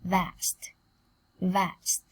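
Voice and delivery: ordinary delivery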